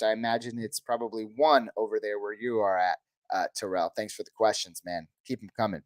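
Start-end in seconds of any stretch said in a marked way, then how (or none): none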